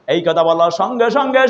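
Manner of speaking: ordinary delivery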